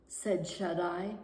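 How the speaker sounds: usual speaking voice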